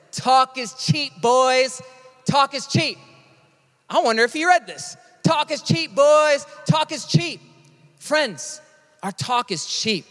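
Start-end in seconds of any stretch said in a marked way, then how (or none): 0.1-3.0 high, slow coach's refrain; drawn-out, punchy coach impression; football coach. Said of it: "My sixth grade football coach always said". 5.2-7.4 raised-pitch coach imitation; high, forceful coach's slogan; football coach. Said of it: "My sixth grade football coach always said". 8.0-10.1 slow, subdued admission; quiet, measured confession; sober appeal. Real, not acted. Turns coach's slogan on the congregation.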